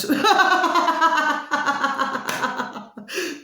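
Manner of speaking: a high, bursting laugh at her own joke; real laugh. Real, not acted. Laughing at her own gobby joke to her viewers, high burst of laughter. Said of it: "Sorry, sorry, my delicious little babies".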